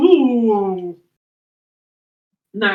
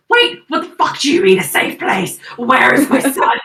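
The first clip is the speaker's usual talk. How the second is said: angry demand; 3 semitones higher, 6 dB louder than usual. Acted. Gerald confronts Sorella about his son, shouting angrily. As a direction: demand it loudly in outrage.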